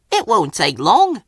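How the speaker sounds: cheerful and hurried; puffed to Flora. A narrator's reading. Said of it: "Thomas puffed to Flora".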